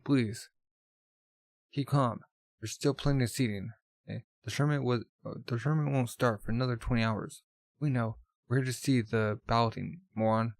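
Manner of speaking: plain reading voice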